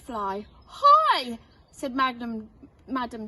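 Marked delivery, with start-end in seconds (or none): 0.7-1.4 high loud sing-song greeting; hearty dragon teacher hello; bright teacher dragon call. Acted. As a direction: call down cheerily from the sky. Madam Dragon calling from the sky.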